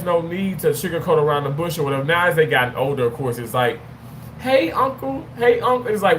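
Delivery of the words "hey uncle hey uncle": high, lightly voiced kids calling for uncle; a hint of kids whining for attention.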